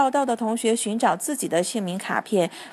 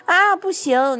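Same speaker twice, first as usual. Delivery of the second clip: put-on boy crying out to protect his hamster; 6 semitones higher, slower than usual. A narrator's reading. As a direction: blurt it in alarm. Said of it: "朱利安叫起来".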